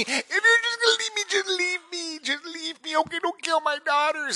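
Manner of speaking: hammed-up tearful begging; imitating crying wife. Husband mimics wife begging him to just leave, very high. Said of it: "she's like looking at me".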